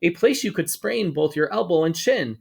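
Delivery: plain reading voice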